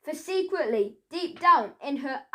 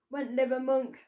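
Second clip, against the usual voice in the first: ordinary delivery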